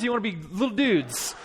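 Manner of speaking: put-on measured, rallying question to kids; coach's challenge. Dad challenging crying boys to toughen up, coaching challenge. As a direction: put the choice to them like a coach.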